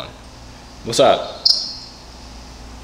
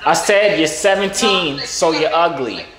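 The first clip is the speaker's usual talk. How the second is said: slow, raised repeat; repeating for the hard-of-hearing; 4 semitones higher than usual. Real, not acted. Repeating to a caller who cannot hear, slow and raised. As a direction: say it higher and drawn out.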